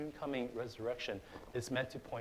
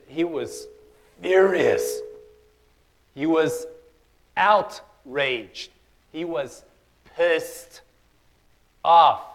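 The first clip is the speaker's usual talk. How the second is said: furious shouted preaching, much louder and higher; booming pulpit outrage; 6 semitones higher, 19 dB louder, much slower than usual. Acted. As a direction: hammer each word like righteous anger. Preacher building to angry climax.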